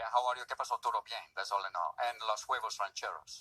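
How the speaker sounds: put-on low English with a Spanish accent. Guest claiming little Spanish, in a mock Spanish accent. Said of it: "you spoke English with a Spanish accent".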